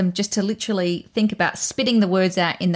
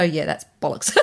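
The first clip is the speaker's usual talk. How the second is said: laughing dismissal; 5 semitones lower than usual. Retold or imitated. Client dismissing thought, laughing.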